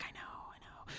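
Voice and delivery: breathless whisper to yourself; hushed aside while meeting a star; starstruck whisper. Retold or imitated.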